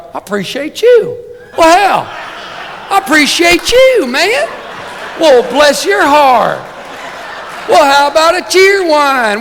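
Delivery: put-on over-the-top Southern accent with laughs; mock Southern accent. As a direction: play a gushing Southern sportswriter in a Southern accent. Sportswriters greeting each other, Southern.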